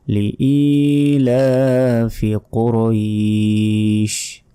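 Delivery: লিইলাফি কুরাইশ recited very slowly, long vowels held; measured model recitation of লিইলাফি কুরাইশ; drawn-out recitation. Shown as a demonstration. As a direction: stretch the end of কুরাইশ. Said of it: "শেষে টান হবে".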